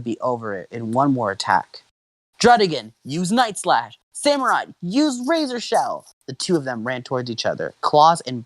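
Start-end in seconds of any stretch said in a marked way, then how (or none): 2.4-4.0 high-pitched trainer's order; raised, excited call; battle command. Acted. 4.1-6.1 excited high summons; answering battle cry. Acted. Rival trainer's answering command.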